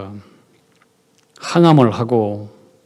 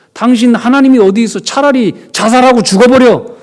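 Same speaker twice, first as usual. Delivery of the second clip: loud, higher mock taunt; a touch of Job's scornful wife; 10 semitones higher, 9 dB louder than usual. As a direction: hurl it like a bitter wife. La femme de Job invective.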